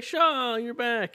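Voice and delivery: high, flirty "Shaw, you're back"; flirty waitress. Acted. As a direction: coo his name like a smitten barmaid.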